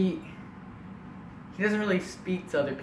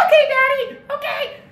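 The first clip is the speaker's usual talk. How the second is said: very high, squeaky compliance; twelve-year-old boy; 10 dB louder than usual. Retold or imitated. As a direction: give in with a little-kid squeal. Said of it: "I'm like this twelve-year-old boy".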